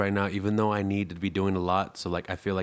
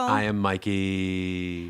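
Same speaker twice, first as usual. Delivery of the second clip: very slow, stretched intro; slow drawn-out intro; much slower than usual. Pretend. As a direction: drag out each word.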